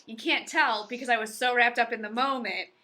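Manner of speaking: usual speaking voice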